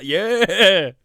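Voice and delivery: a sudden loud, high yeah; excited yell. Real, not acted. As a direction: cheer it out loud at the screen. Cheering at the wrestling footage.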